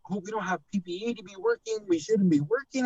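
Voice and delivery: high-pitched mimicked grumbling; mock complaining. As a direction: imitate a coworker's high, fussy complaint. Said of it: "she's complaining about".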